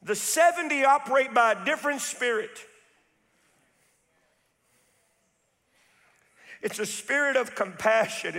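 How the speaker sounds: high, loud, rhythmic preacher's cry; full-force preaching. Real, not acted. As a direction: preach it hard to the congregation.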